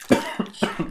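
sudden coughing fit from choking on saliva. Real, not acted. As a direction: cough hard as if something went down the wrong way. (tosse de engasgo com saliva)

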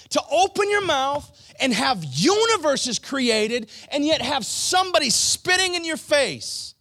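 high, loud, forceful preaching; impassioned preaching. Real, not acted. Preacher asks congregation to grasp the ridiculous, impassioned shouting. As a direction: preach it at full force, incredulous.

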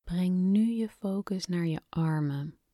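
hushed guided meditation voice; breathy, calm whisper guiding relaxation. Acted. Guided relaxation exercise begins.

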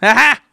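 loud triumphant ha ha; gloating laugh. Real, not acted. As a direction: crow over the win. Gloating at friend.

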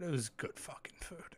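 hushed whisper. Real, not acted. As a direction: whisper it like a secret. Whispered aside about food.

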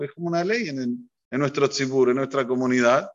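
normal talking voice

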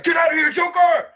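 high, loud imitated order; Commander Shepard. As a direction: shout it like a commander ordering retreat. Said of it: "So she'd have been like".

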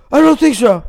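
hammed-up distressed son; imitating panicked friend. Panicking friend answering his mom.

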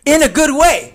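high, loud backpedal; emphatic reassurance. Real, not acted. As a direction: burst out with the joking clarification. Hastily softens his "it's also bad.

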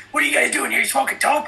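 high-pitched, sudden cop confrontation; high, accusing cop questioning; imitated cop challenge. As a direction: act out a cop catching someone red-handed. Cop surprises them in retold story.

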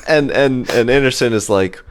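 normal talking voice

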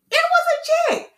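very high-pitched, amazed squeal; excited squeal. Retold or imitated. Vlogger telling viewers the check really came, squealing excitement. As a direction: squeal the news in delight.

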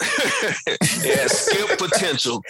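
amused, drawn-out delivery through real laughter; laughing. Real, not acted. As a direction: laugh your way through the words. Laughs while passing judgement.

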